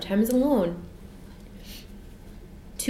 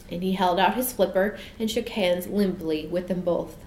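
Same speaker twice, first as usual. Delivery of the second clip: plain reading voice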